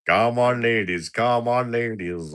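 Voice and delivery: calling out like a stall holder; market trader call. Pretend. Streamer playing a market trader hawking to ladies. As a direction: hawk it to the crowd.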